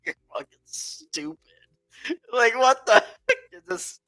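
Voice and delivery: high-pitched, breaking into laughter; laughing disbelief. Retold or imitated. Retelling the friends' reaction to the insult, laughing disbelief. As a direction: squeeze the retold reaction out through laughter.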